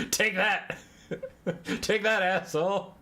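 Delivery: taunting while laughing. Real, not acted. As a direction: laugh through the insult. Laughing at own joke.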